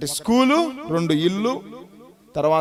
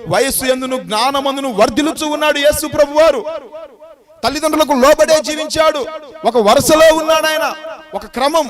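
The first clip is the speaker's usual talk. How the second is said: building louder and higher; fervent pulpit proclamation; preaching at full force; 5 semitones higher, 5 dB louder than usual. Acted. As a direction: climb to a fervent peak. Building sermon point about Jesus.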